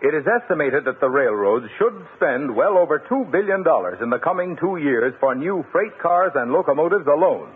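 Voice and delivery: ordinary delivery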